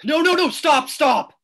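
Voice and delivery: urgent, loud "stop"; desperate shout to keep her from walking on; panicked loud pleading. Acted. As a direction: cry out to halt her at once. Panicked man stops friend.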